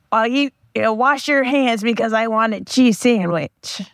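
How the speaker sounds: a second-hand, hammed-up celebrity voice telling a deli joke; friend's Gilbert Gottfried impression. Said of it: "try to impersonate my friend telling the joke".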